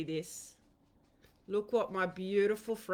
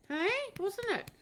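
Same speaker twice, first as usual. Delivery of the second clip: pet talk; 12 semitones higher than usual. Real, not acted. Asking her dog.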